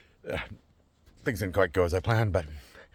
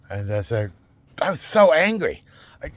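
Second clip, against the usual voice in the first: ordinary talk, nothing marked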